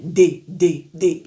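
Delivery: D with the tongue on the palate, French style; D français sur le palais. Shown as a demonstration. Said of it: "va se prononcer sur le palais".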